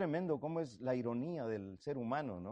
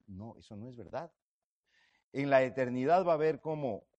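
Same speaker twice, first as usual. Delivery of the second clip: ordinary talk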